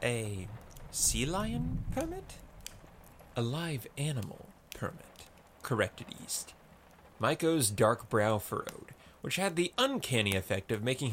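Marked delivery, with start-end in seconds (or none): 0.0-5.2 hesitate, then offer it up quietly; hesitant. A narrator's reading. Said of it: "Maiko hesitated".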